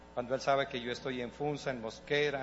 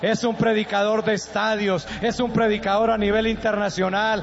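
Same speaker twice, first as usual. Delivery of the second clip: loud, higher, slow fervent preaching; preaching at full force; 11 semitones higher, 9 dB louder, slower than usual. Acted. Pastor preaches loudly to parents about their children. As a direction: thunder it from the pulpit.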